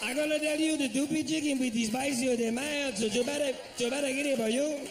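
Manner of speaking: put-on a cashier struggling with the order in a Spanish accent; hammed-up Spanish-accented cashier; mock Spanish accent. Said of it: "He kept looking at the menu".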